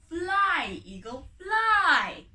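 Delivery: loud, slow, drawn-out call. Acted. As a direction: release the bird with a cry. Final command at sunrise.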